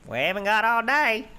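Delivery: impatient high shout from the rider. Acted. Rider snaps at the party to hurry. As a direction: bark it down from the saddle. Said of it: "and the rider goes".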